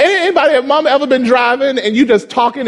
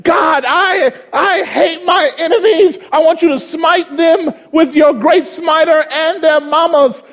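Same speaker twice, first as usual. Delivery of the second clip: David going off; 9 semitones higher than usual. Retold or imitated. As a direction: rant at God like furious David, half joking. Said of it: "David would go off on God".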